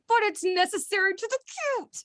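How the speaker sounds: high whiny plea; whiny protest. Retold or imitated. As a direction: whine it like a sulky writer. Whiny self-quote.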